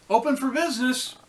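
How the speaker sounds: high, loud and quick sing-out; chirpy shop-opening call; cheerful announcement. A narrator's reading. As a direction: call it out brightly to anyone listening. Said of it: "she would say".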